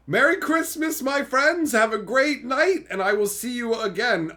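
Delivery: high, loud festive farewell; cheery sign-off. Real, not acted. Signing off to stream friends, high loud cheerful. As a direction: send friends off with holiday cheer.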